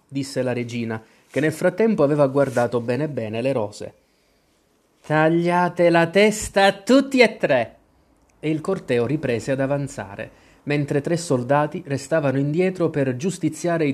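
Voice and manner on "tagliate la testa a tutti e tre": high, drawn-out royal command; a furious queen passing sentence; imperious shouted order. A narrator's reading. Queen sentences three gardeners to death, shouting imperiously.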